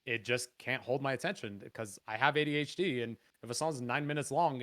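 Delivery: ordinary talk, nothing marked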